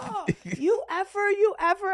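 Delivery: high, loud mock cursing; imitated pained outrage. Man just bitten by a coyote, mock outraged cursing. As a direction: curse like the bitten man, hammed-up.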